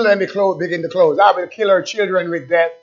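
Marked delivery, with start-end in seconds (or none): 1.2-2.7 slow proclamation with long-held opening words; solemn scripture reading. Real, not acted. Preacher reading God's warning to the churches, solemn proclamation. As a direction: intone the verse, drawing out key words.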